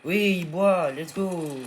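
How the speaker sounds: loud happy cry; triumphant shout of relief; delighted. Real, not acted. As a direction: celebrate the dino drinking. Pet finally drinks.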